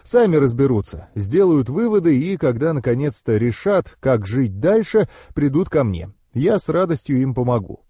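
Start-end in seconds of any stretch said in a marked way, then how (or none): none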